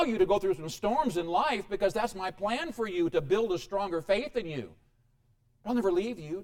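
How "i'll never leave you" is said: usual speaking voice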